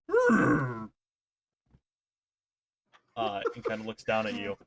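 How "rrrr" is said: higher rattling zombie growl. Acted. Game master as battered zombie, growling at attacker. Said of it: "and it goes".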